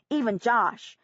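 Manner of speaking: plain reading voice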